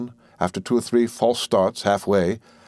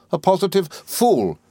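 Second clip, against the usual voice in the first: high, slow, emphatic scorn; angry insistence; 9 semitones higher, slower than usual. A narrator's reading. Miss Murdstone overriding mother's protest, angrily. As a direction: repeat the insult indignantly, drawing it out.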